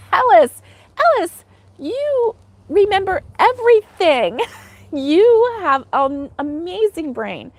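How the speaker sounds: laughing. Real, not acted. Laughing at chat's good memory.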